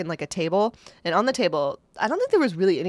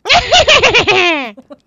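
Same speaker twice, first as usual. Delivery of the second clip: loud high witch cackle; evil cackle; 12 semitones higher, 12 dB louder than usual. Shown as a demonstration. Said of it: "give me your best witch laugh".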